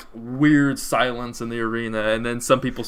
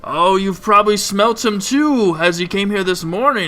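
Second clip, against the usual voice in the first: high, loud ring announcer, drawing out the big finish; arena taskmaster; 8 semitones higher, 7 dB louder than usual. Acted. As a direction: whip up the crowd as the arena announcer. Taskmaster introduces second fighter.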